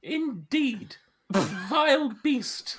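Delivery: high, slow, scandalised lady; pearl-clutching. Acted. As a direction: gasp it out like a prim lady. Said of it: "She's clutching her pearls as she says that".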